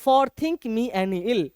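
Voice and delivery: very slow recital of the verse; slow poem reading. Shown as a demonstration. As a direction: read it out word by word. Teacher recites poem line.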